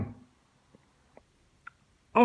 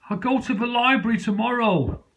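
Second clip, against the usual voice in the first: mock reply; 8 semitones higher than usual. His reply, voiced.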